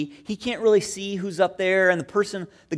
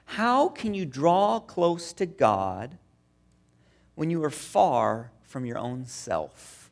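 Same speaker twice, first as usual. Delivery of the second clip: slow quote; 3 semitones lower, much slower than usual. Retold or imitated. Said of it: "Augustine says".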